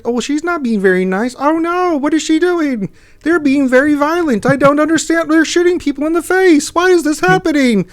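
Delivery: high, loud mock panic over the game; dramatic alarm.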